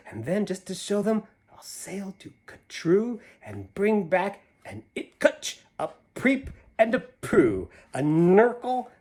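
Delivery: lively rhyming storybook read; reading to children. A narrator's reading. Reading a picture book aloud to children, lively. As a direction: bounce through the rhyme for the kids. Reads Seuss rhyme aloud.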